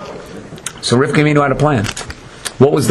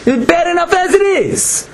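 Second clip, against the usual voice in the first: very high, exasperated protest; complaining; 12 semitones higher than usual. Retold or imitated. Satan refusing to get involved, complaining at high pitch. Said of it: "The Satan starts complaining".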